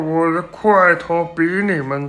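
very loud, high bragging mummy; dusty mummy rasp. A narrator's reading. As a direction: boom it like a thousand-year mummy. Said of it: "木乃伊自夸道".

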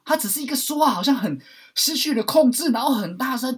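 loud. Shown as a demonstration. Demonstrating loud uncontrolled talk, shouting. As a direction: yell like someone losing control.

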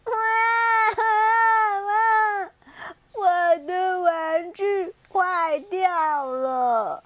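put-on slow, high sobbing child voice; crying. First penguin cries to the little bear about its broken toy. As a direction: sob out the words like a little child. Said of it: "第一只小企鹅哭着说".

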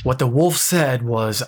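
usual speaking voice

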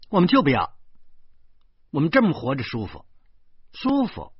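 更响、更高地抢着说; 像放炮仗一样脱口而出; 大声宣布. A narrator's reading. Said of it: "我老婆就大声说". (老婆抢在丈夫前面大声宣布)

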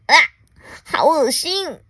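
high, swooping yuck; disgusted alien. Acted. Alien spits out a biscuit, disgusted. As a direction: recoil in acted storybook disgust.

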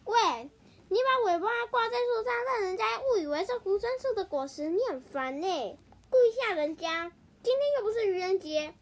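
high, loud annoyed scolding. Acted. Hippo scolds the mouse for scaring her, annoyed scolding. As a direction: tell the prankster off sharply.

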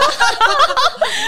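high laugh rising up. Real, not acted. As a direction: burst into laughter. Teacher's absurd offer retold.

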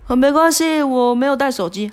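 a hint of choked politeness; herself tearful. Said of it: "我就说".

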